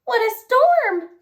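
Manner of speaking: high, chirpy bird voice; twittering wren. Acted. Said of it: "twitters wren".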